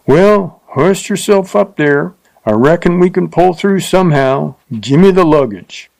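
a coarse rider bossing her; rough cowhand, gruff. A narrator's reading. Rough man's dialogue.

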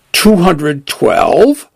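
slow, aghast question; drawn-out shocked disbelief. Acted. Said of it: "the vehicle said aghast".

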